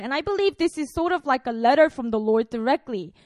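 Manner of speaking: ordinary delivery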